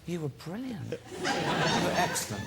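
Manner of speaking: breathy laughing praise, slow; over-praise while laughing; gushing through laughs. Retold or imitated. Flattering the couple he scolded, laughing. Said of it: "I said".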